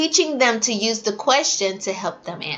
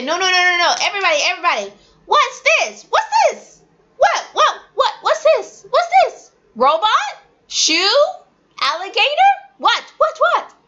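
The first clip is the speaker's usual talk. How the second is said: animated preschool teacher; 11 semitones higher than usual. Shown as a demonstration. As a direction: hype up little kids. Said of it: "You say".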